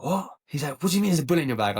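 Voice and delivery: high-pitched incredulous questioning; retold shocked dad. Dad learns of bullet in son's bag, retold incredulity. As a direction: imitate a father's disbelief, pitch jumping up.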